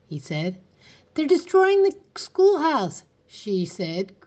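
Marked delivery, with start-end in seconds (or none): 1.1-3.0 breathless and high, louder; panting out the alarm; gasping for breath. A narrator's reading. Teacher reaching the sheriff, gasping for breath. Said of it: "gasping for breath".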